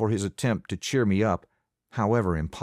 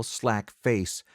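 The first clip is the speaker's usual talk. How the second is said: ordinary delivery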